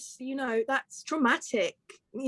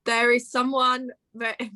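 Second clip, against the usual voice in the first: normal talking voice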